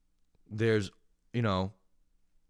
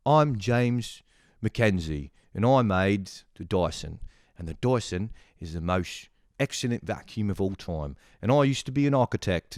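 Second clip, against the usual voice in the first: put-on British accent. Vacuum inventor introducing himself on TV, mock British accent. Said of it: "and a British accent".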